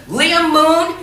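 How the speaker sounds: hollering across the house for Liam Moon; shouted call. A narrator's reading. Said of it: "Grandma shouted".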